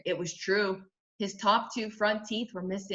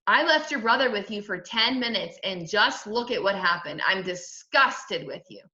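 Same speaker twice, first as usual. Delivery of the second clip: ordinary delivery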